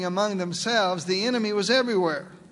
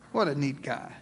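softer, sly smirking aside; mock smug aside; 5 semitones lower, 5 dB quieter than usual. Lot gloating to himself over his uncle, sly and soft. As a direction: gloat under your breath. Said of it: "pretending to be sad".

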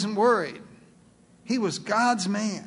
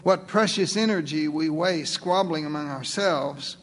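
ordinary talk, nothing marked

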